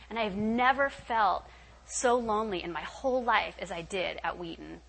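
ordinary delivery

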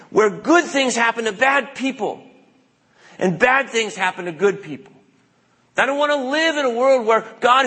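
high, insistent whining protest; Jonah's protest. Acted. Preacher voices Jonah protesting God's mercy on Nineveh, high whine. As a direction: plead like an outraged prophet refusing God's mercy. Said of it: "And he says".

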